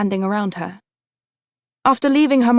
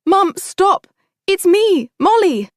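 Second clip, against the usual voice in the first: high, loud panicked cry; frightened scream; 9 semitones higher, 4 dB louder, slower than usual. A narrator's reading. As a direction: cry out in terror. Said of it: "Molly screamed and said".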